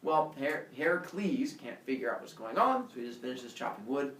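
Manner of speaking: plain reading voice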